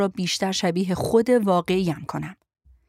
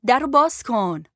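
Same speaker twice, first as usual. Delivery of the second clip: calling through a door; 4 semitones higher, 4 dB louder than usual. Acted. Shouting at closed cabin door.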